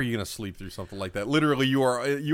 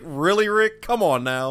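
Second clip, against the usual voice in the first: mock exasperation; 6 semitones higher, 4 dB louder, slower than usual. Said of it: "I was just like".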